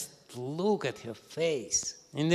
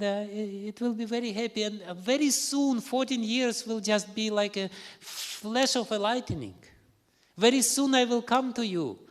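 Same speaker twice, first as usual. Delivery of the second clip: high, louder eager reassurance; earnest promise; 7 semitones higher, 4 dB louder than usual. Acted. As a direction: reassure his mother, voice lifted high. Rama promises mother, raised voice.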